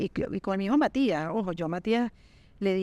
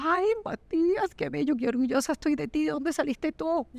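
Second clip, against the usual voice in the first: proud mother gushing; 6 semitones higher than usual. Retold or imitated. Mother praising her son Matías, high and gushing. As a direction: gush over him like a proud mom.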